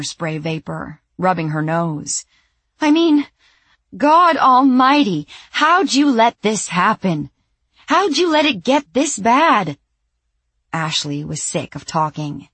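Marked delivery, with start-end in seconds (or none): 2.8-9.8 high, loud, exasperated shouting; furious rant. Acted. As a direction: rant in outrage. Sandy ranting at Ashley.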